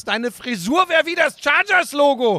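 high, slow mocking repeat. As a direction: parrot it back in disbelief. Mocking partner's silly claim.

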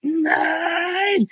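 drawn-out slow-motion no. Pretend. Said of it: "aber in Zeitlupe natürlich".

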